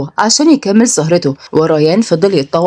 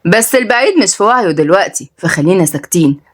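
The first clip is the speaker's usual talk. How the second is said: ordinary delivery, nothing marked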